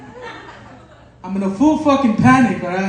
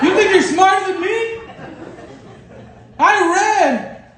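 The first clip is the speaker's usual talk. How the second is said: high, mock-furious rant at a heckler; mock outrage; 9 semitones higher than usual. Comic scolding a heckler, mock-furious and high. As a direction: play indignant, pitched way up.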